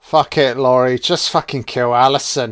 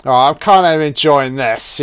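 ordinary delivery

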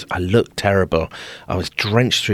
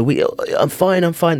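much higher, forced "I'm fine"; stuck in the toilet, calling out to staff in a much higher strained voice; high strained reassurance; 9 semitones higher than usual. Retold or imitated. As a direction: insist you're fine through the door, voice going up. Answering from the locked toilet.

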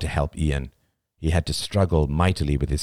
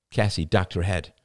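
usual speaking voice

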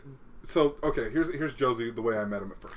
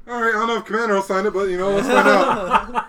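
laughing quote; 7 semitones higher, 11 dB louder than usual. Retold or imitated. Officer voiced in army story.

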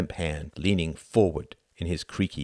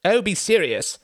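high, sharp and louder; snapping; 7 dB louder than usual. Acted. Sam annoyed at Cuthbert's joking, snapping high and loud. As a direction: cut him off with irritation. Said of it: "snapped Sam".

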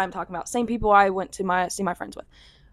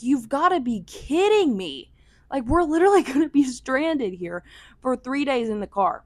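exasperated; 5 semitones higher, 4 dB louder than usual. Retold or imitated. To the Airbnb owner refusing them, exasperated half-laughing disbelief.

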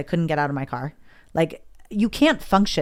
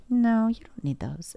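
soft, slow, higher imitating a calm friend; calm reassurance; 5 semitones higher, 5 dB quieter than usual. Lash artist calmly reassuring the anxious client, soft slow voice. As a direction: ease her worries gently, a little slower. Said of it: "And she's so calm".

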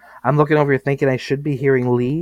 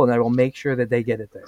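ordinary talk

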